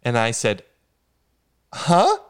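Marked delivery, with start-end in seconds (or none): 1.7-2.2 huh jumps way up, then slow disbelief; a stunned, high-pitched huh, drawn-out question; incredulous. Retold or imitated. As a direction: sound baffled and thrown. Incredulous reply to absurd claim.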